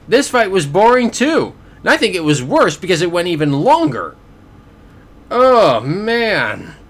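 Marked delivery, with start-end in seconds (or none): 5.3-6.8 exasperated groan. Real, not acted. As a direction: groan it out, fed up with the boredom. Exasperated at a boring fight.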